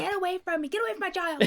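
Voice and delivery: high mock-panicked plea; silly clown.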